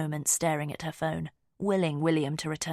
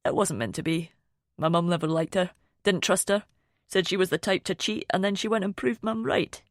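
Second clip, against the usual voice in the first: lightly voiced Scottish man. A narrator's reading. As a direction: humour him in a light Scottish accent. Finn's voice, about his ex-wife.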